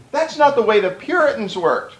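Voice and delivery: usual speaking voice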